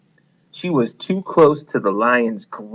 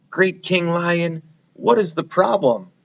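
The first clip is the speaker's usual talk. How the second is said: higher mouse voice asking the trapped lion; worried mouse; 7 semitones higher than usual. Acted. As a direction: call to the king with concern.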